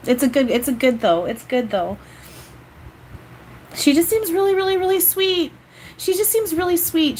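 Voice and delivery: moved and holding back tears; teary. Real, not acted. Medium moved by seeing the aunt, near tears. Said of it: "why is it making me wanna cry".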